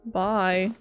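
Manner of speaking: ordinary delivery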